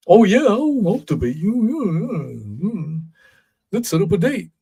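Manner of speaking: high, hammed-up eager voice, yeah yeah yeah; mock eager pushover. Host mocking Twitter guy agreeing to debate, high hammed-up eager voice. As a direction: play a fake-agreeable guy brushing him off.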